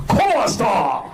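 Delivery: put-on high shouted Mexican-Japanese accent parody; mock Mexican-Japanese accent. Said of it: "That's gotta be a weird accent".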